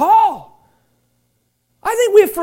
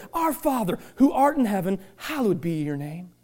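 lower, softer, reverent prayer; prayerful recitation; 6 semitones lower, 3 dB quieter than usual. Shown as a demonstration. A pastor reciting the Lord's Prayer to the church, lower and soft. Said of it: "this is a great thing to begin to pray".

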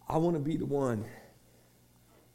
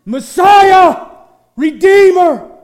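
desperate shout, much higher and louder, each name flung out; crying out; 21 dB louder, much slower than usual. Pretend. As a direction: cry out to Jesus with everything. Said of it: "if the church begins to cry out".

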